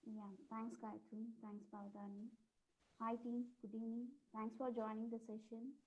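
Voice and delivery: very soft, far from the mic; faint, hard-to-hear voice; barely audible. Real, not acted. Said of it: "I think your voice is very low".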